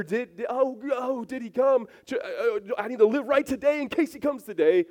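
high, flustered stammer; a hint of a nervous worrier; mock fretful worrier. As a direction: play the anxious believer. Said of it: "We don't have to wonder".

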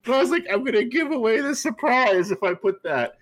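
high, wheezy talking through laughter; tickled, laugh-choked squeal; delighted laughter. Real, not acted. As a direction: explain while still cracking up. Laughing at the co-host's title joke.

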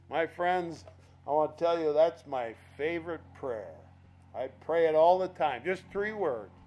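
normal talking voice

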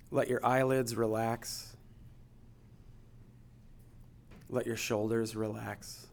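guided meditation. Acted. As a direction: gently guide them into stillness. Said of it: "I'm gonna take us through a guided meditation".

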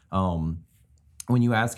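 ordinary delivery

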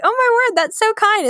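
high-pitched grateful gushing; touched, grateful surprise. Retold or imitated. Student thanking a generous stranger, high grateful gush.

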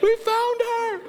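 very high, gleeful cheer; cheering kids. Retold or imitated. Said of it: "kids ... started cheering".

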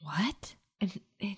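soft unvoiced surprised whisper; whispered what. Real, not acted. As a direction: whisper in shock. Whispering in tomb.

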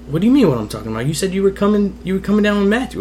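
retold high-pitched protest; indignant protest. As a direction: protest to mom like a confused, upset kid. Retold argument with his mother.

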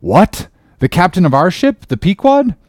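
high, loud astonished question; surprised. A narrator's reading. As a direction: pitch shoots up in disbelief. Ishmael astonished.

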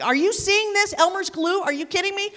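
high, loud mock panic at the flight attendant; playfully horrified plea; panicked disbelief. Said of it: "looking at her with just fear in my eyes".

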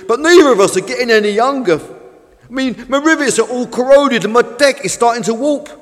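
loud, high-pitched prophecy of doom; doom-laden old fishing boat. Acted. Old Fish warns Tug they will all sink, ranting. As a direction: warn like a worked-up old seafarer.

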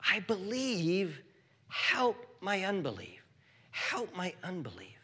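soft, halting plea with a touch of anguish; tearful plea. Acted. Father crying out to Jesus for help, softly pleading. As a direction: plead it gently, pausing between phrases. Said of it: "cried out and said with tears".

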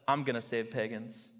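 lightly voiced steady God, stressing "I'm"; calm God answering. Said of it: "and God says".

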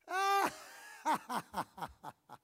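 high, loud scornful laugh; jeering crowd laughter; mocking laugh. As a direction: laugh at the rabbi with contempt. Scoffers mock Jesus with laughter.

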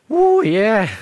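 high, loud whoop of delight; elated cheer. Acted. Peter reacting to Jesus naming him the rock, elated. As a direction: cheer like you've just been praised.

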